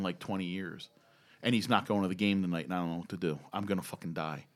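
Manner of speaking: low, soft, defeated murmur; subdued, sinking voice of retold dread; retold glum confession. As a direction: imitate a friend quietly admitting he's done for. Confesses the affair, fears the fallout.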